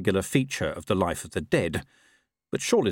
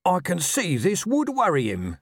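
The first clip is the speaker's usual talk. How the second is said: coaxing, keep-them-talking voice; 9 semitones higher than usual. A narrator's reading. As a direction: prompt the witness gently to go on. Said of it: "in a keep-on-talking tone of voice".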